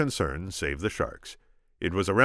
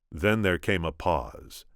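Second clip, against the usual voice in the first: plain reading voice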